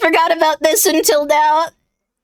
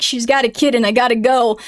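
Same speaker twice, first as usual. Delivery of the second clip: imitating the driver; 9 semitones lower than usual. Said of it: "they were like".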